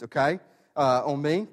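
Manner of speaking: ordinary talk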